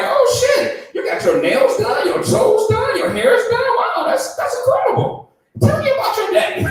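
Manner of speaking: loud, squealing high amazement; hammed-up, high-pitched fascination; over-attentive husband. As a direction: gush loudly over every detail. Acted over-attentive husband on medication.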